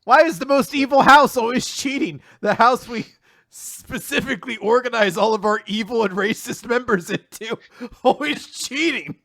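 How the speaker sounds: loud, high sarcastic indignation; cohost's loud mock-indignant rant, laughing at the end; mock outrage. Real, not acted. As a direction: rant in fake disbelief. Mock outrage about Slytherin.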